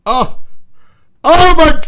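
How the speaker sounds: high piercing shriek of shock. Real, not acted. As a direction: cry out at the sight. Shocked by spider video.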